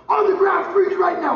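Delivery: loud, high barked police command; commanding shout. Retold or imitated. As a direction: yell it like you're taking the man down. Fake arrest of bail jumper.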